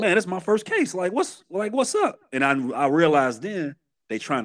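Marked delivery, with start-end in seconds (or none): 0.0-2.2 much higher-pitched bewildered protest; high, questioning retold complaint. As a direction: quote yourself protesting, voice jumping right up. Retold protest at his first case.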